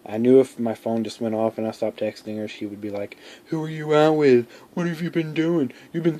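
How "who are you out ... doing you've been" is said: high, suspicious accusing questions; jealous girlfriend. Pretend. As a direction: voice her jealous interrogation. Jealous girl imagined texting.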